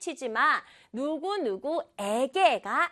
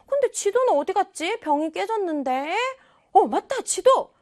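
high, louder startled recollection; sudden realization; 6 semitones higher, 4 dB louder, faster than usual. Acted. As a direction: act a child suddenly remembering something important. Acts child suddenly remembering map.

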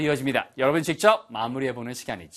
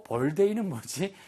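ordinary delivery

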